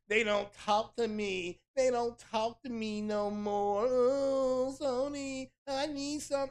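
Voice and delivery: high, whining mock sob voice; mock whiny crybaby. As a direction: imitate them crying to Sony. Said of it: "that's what they sound like".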